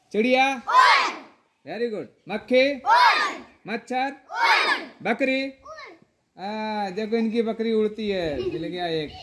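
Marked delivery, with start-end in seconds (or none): 0.1-5.4 loud, high game calls to the class; calling out. Real, not acted. Teacher calls out game words. 6.4-8.4 playful teasing call to the class. Real, not acted. Teasing a child who slipped.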